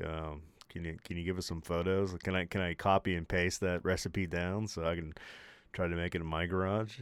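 quiet, sheepish request; sheepish soft ask. Retold or imitated. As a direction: ask shyly, half under your breath. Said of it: "And then I'm like".

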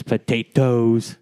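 put-on potatoes drawn out slowly in a hobbit-style accent; slow, put-on Lord of the Rings accent. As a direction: stretch out potatoes in a mock Middle-earth accent. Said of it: "how they say in Lord of the Rings".

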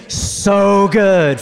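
big loud, high group shout; yelled from the gut. Real, not acted. Preacher leading the congregation's yelled response, loud. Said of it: "you guys yell out the choice".

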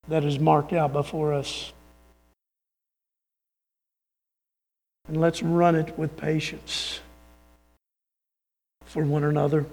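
low, hushed sermon delivery; soft, spaced-out reverent appeal; solemn exhortation. Acted.

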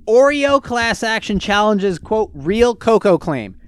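slow, loud announced title; headline read-out. Real, not acted. Host reads an article title to listeners, slow and emphatic. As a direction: proclaim it word by word.

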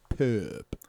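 "Purp" with a French accent, soft vowel. Shown as a demonstration. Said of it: "like, a French accent".

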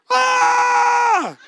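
high, loud panicked yell up the stairs; frantic shout. Retold or imitated. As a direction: bellow for the kids in a panic. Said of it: "I'm like".

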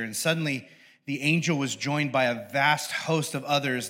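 ordinary talk

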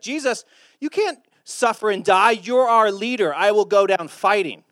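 loud, high impassioned vow; defiant protest. Acted. Disciple refusing to let his leader die, loud defiant protest.

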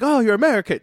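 mock delighted cry, pitched high and loud; excited local. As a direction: play a local lighting up at an American. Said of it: "light up and be like".

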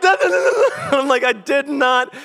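high, loud mock panic; comic flustered protest; panicked denial. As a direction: fire off frantic high denials. Retold panic at embarrassing text.

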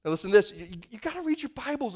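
usual speaking voice

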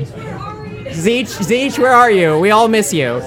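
ordinary talk, nothing marked

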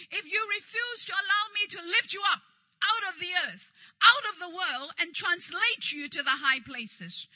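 loud, driving sermon warning; forceful preaching. Real, not acted. Preacher warns those refusing to be lifted, forceful preaching. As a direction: press the warning home, peaking midway.

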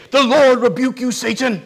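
mock stern rebuke to Satan. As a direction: rebuke him like you mean it. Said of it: "he doesn't get all riled".

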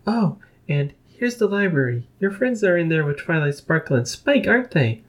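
shy little pony, hushed; Fluttershy voice. A narrator's reading.

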